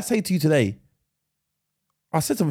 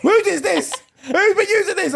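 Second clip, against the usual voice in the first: high-pitched mock indignation, half laughing; mock-outraged mum; 11 semitones higher, slower than usual. Mum finds the used sock, mock-outraged. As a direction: demand it like a scandalised mum, played for laughs.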